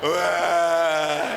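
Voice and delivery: low, long mock wail; hammed-up crying; imitated children's wailing. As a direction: bawl it out, drawn-out and put on. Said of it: "lihat kamu menangis".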